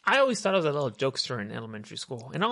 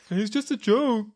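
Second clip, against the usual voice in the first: high, loud put-on crying voice; hammed-up teary whine; mock tearful protest; 8 semitones higher, 5 dB louder, slower than usual. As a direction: imitate a kid pleading through tears. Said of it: "I was already crying".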